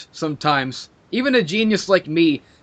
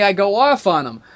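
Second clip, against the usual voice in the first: usual speaking voice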